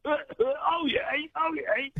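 a mock call strangled by steam; high, straining "Oh, yay" losing the voice; choked-up crier call. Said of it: "I couldn't speak for five minutes".

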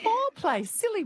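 high, loud exclamation; mock surprise. Real, not acted. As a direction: act surprised at the word. Host repeats word surprised.

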